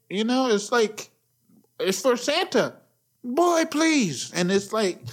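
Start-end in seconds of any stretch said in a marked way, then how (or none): 1.8-4.3 dismissive mom, pitched up high; mock mom, higher. Mom brushing off her son, higher voice. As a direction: brush the kid off like a mom would.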